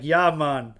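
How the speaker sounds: quick loud Jamaican "yeah, man"; Jamaican accent. Shown as a demonstration. Trying a Jamaican accent for a chat request. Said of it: "all I know how to say in Jamaican".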